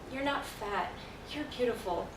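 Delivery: soft motherly comforting voice; gentle reassurance. Retold or imitated. Mother telling her daughter she is not fat, gently reassuring. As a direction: reassure softly, as a mother would.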